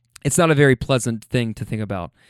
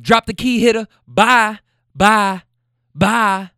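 loud, raised dismissal as the woman; imitated yelling; 8 semitones higher, 6 dB louder, slower than usual. Woman dismissing her man, imitated loud yelling. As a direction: punch out each farewell loudly. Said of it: "now they're screaming".

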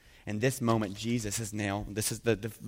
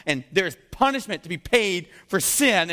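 stern God; 8 semitones higher, 7 dB louder than usual. Acted. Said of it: "He's going".

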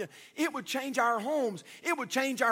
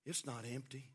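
hushed, low, tender voice; tearful little girl, imitated; 11 semitones lower, 14 dB quieter than usual. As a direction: answer very softly with pauses. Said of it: "looked up at him with tears in her eyes".

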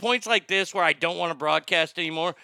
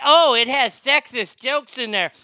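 mock whine; 6 semitones higher, 6 dB louder than usual. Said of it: "mocking woke critics".

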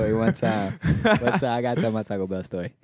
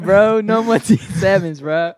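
loud laughing outrage; 10 semitones higher, 9 dB louder, slower than usual. Real, not acted.

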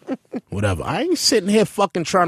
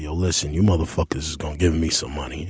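tough demand; 9 semitones lower, 4 dB quieter than usual. Retold or imitated. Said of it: "I was like".

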